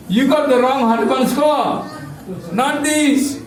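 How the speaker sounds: a touch of an annoyed clerk; mock scolding. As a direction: tell them off for the mistake, playfully. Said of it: "They scolded Yamaduttas".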